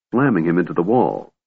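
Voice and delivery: ordinary delivery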